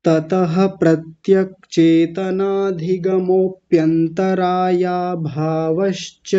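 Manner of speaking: sutra drawn out slowly without a gap; slow continuous recitation. Shown as a demonstration. Teacher models the sutra for students, slow and unbroken. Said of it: "देखिए मैं कैसे बोल रहा हूँ".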